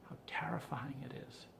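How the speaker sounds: hushed unvoiced whisper; whispered terror. Real, not acted. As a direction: whisper it, as if afraid to say it aloud. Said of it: "I want you to understand".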